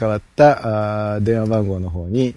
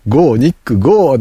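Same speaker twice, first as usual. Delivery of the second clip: loud, rushed pun pitch; playful shout; 4 semitones higher, 8 dB louder than usual. Shown as a demonstration. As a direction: toss out the joke with a shout. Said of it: "外人さんの".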